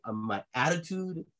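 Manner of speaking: ordinary talk, nothing marked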